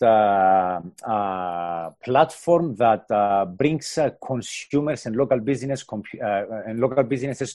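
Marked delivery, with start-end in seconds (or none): none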